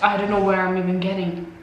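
normal talking voice

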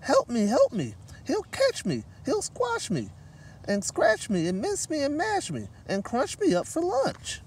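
high, panicky mouse pleading; frightened squeak. Acted. Mouse begging for help from the gorilla, high and scared. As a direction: squeak it out in terror. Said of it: "she squeaked".

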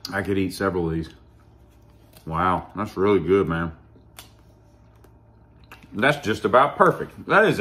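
speaking between bites; chewing while talking. Real, not acted. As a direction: mouth partly full of candy. Seen 1: man bites candy at 8.0/11.7, jaw chewing between phrases.

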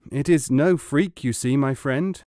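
plain reading voice

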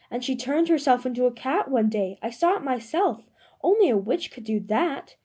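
put-on a timid serving girl shouting with the mob. A narrator's reading. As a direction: yell it out to the whole crowd. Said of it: "shouting just as loudly".

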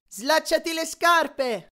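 high, loud, drawn-out call; urgent long-carrying shout; shouted advice. A narrator's reading. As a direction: call the instruction after someone racing away. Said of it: "Annabeth gli suggerì".

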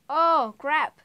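grito fuerte de sorpresa; suéltalo de golpe, sobresaltado; exclamación de sorpresa. Real, not acted. Exclamación al caer. (oh crap gritado con sobresalto)

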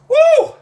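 piercing high, loud "woo"; sudden whoop as the burn hits; heat whoop. Real, not acted. Pepper heat hits mid-sentence.

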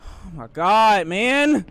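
slow, loud drawn-out cry, rising high; distressed wail. Real, not acted. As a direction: wail it out, overwhelmed by nerves. Distress after scary jump.

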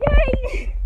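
ordinary delivery

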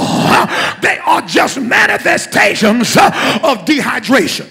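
ringing call-and-response sermon; preaching. Acted. Pastor leading congregation call and response, rousing preaching. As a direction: drive each point home, then call for the answer.

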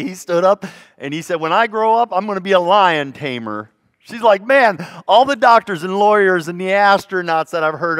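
full-voiced sermon tale; preaching at full force. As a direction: rouse the church with the story.

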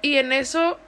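ordinary delivery, nothing marked

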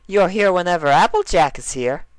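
very high cheerful pony voice; bright and goofy, way up high; Derpy voice. Acted. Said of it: "Derpy said".

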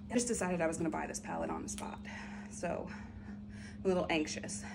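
hushed, whispered confession; anxious whisper. Real, not acted. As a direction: whisper it like a nervous secret. Confiding nerves about an impulse buy.

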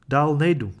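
loud, firm refusal; firm declaration. Acted. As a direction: plant your feet and declare it. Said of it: "prohlásil a zůstal stát".

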